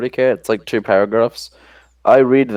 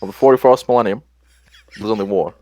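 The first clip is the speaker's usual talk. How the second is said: mock presidential address, lightly put on; Obama; 4 semitones higher, faster than usual. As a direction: parody the American president's speechmaking. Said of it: "record that entire thing in the Obama voice".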